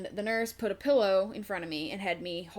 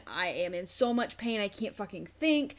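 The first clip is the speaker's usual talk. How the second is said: usual speaking voice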